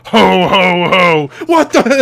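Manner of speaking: a hint of Santa, then surprise; lightly voiced Santa. Masked wrestler on top, lightly voiced Santa laugh. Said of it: "Suicide's on the top going".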